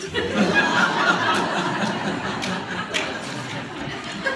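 a long, helpless breathy laughing fit; real laughter. Real, not acted. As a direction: crack up at your own joke. Laughs at own punchline.

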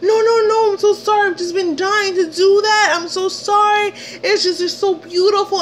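high-pitched pleading man; frantic apologizing. Retold or imitated. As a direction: imitate him begging forgiveness in a high voice. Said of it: "he's apologizing".

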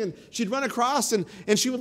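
ordinary delivery

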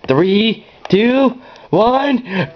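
rising hype countdown before the fire; building excited countdown. Real, not acted. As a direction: build the hype toward zero. Countdown to burning house.

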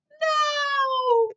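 high, loud "No!"; loud cry. Acted. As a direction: cry out in dismay. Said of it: "said Cynthia".

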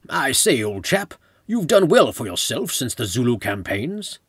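loud, hammed-up British accent; mock British colonial officer accent; best British accent. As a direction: put on an old-chap British voice. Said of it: "spoke in my best British accent".

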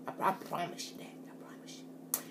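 soft unvoiced whisper; whispered promise. Real, not acted. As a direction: breathe the promise like a secret.

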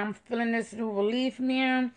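ordinary delivery

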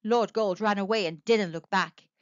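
ordinary delivery, nothing marked

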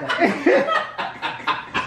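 high, helpless laughter; real laughing fit. Real, not acted. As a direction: crack up at your own confusion. Cracking up during the game.

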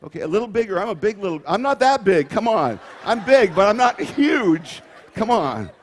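hammed-up child bossing Dad about; playful little girl. Preacher as daughter, teasing the volunteer dad, mock pleading. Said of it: "play the part of a cute little five-year-old girl".

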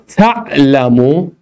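تعلمو spelled out very slowly, rising end; slow dictation. Shown as a demonstration. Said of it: "امر اینا رو بسازید".

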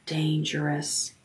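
soft, hushed inner warning. A narrator's reading. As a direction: let it slip out under the breath. Inner buzz warning again.

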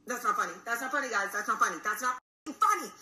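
increasingly loud, indignant protest; retold protest, building in force; rising indignation. Upset at being scammed, retold.

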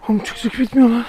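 out of breath from the climb; puffing after endless stairs; gasping for air. Real, not acted. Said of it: "Nefes nefese kaldım". (nefes nefese)

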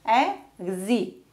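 Execution broken into e-xe-cu-tion; syllable by syllable. Shown as a demonstration. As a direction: leave a gap after each beat of Execution. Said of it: "how to divide words".

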